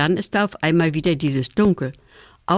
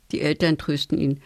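ordinary delivery, nothing marked

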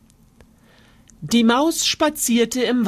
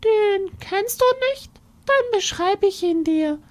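mouse spinning a scary tale, much higher; boastful little mouse; 12 semitones higher than usual. Acted. Mouse scaring the fox with the monster, high and sly.

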